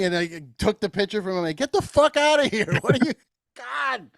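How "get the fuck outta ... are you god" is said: high, half-laughing mock scold. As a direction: shoo him off in amused disbelief. Takes pitcher from old dude.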